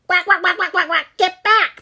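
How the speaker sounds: high, loud angry quacking; squawking duck. A narrator's reading. Mother duck squawks at the blue jay, high and loud. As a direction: squawk at the bully to get back. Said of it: "Missus Duck squawked at Vicky".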